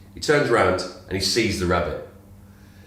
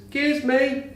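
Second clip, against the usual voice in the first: much higher little rabbit voice; high voiced rabbit asking for carrots; talking rabbit; slower than usual. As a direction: pipe up high like a tiny rabbit. Joke, rabbit returns.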